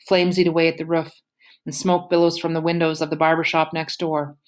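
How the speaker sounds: usual speaking voice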